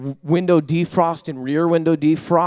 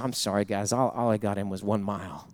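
sorry worker; 6 semitones lower, 6 dB quieter than usual. Retold or imitated. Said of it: "He said".

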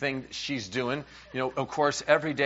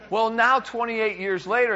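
ordinary talk, nothing marked

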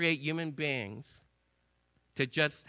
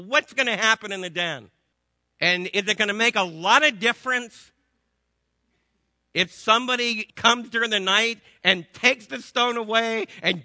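loud, driving preacher's climb; full-force preaching; 5 semitones higher, 8 dB louder than usual. Acted. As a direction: build it up and hammer the congregation.

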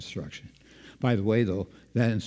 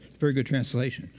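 normal talking voice

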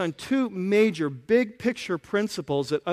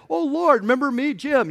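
pleading claimant; 7 semitones higher, 3 dB louder than usual. Retold or imitated. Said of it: "people who are gonna claim".

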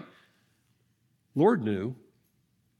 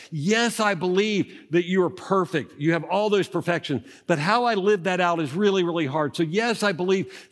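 louder and higher, stressing each "do"; passionate preaching; 5 semitones higher, 4 dB louder than usual. Acted. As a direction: preach it with full conviction. Preaches at full force.

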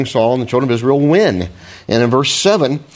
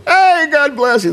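mock cheery greeting. Hypocrite greeting someone with fake cheer. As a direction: sing out a phony blessing. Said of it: "you know people like that".

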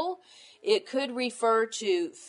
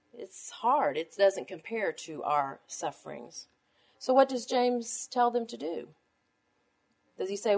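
normal talking voice

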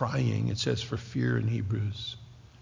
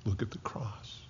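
softer, whispered, as if voicing God; hushed reverent whisper; 12 dB quieter than usual. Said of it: "And God says".

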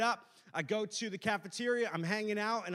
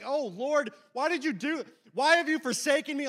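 loud, high cry to heaven; mock desperate plea; 7 semitones higher, 9 dB louder than usual. Humiliated student crying to the Lord, mock despair. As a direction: wail a hammed-up prayer of despair.

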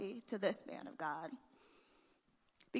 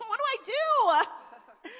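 high, loud, slowed whiny plea; hammed-up begging for help; mock helpless plea; 7 semitones higher, 7 dB louder, slower than usual. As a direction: ham up a panicked young pastor. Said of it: "I'd be like".

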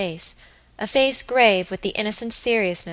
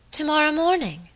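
high-pitched echo of surprise; startled exclamation; 8 semitones higher than usual. A narrator's reading. My lady learns her guest leaves tomorrow, startled. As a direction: repeat it as a sudden, surprised question. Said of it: "exclaimed my lady, looking up suddenly".

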